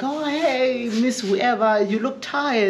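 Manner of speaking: high, sing-song mock greeting; imitated friendly greeter. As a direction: chirp it up like a cheery passerby. Imitating someone greeting older lady.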